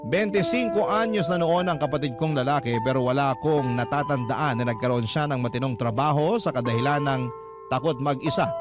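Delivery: normal talking voice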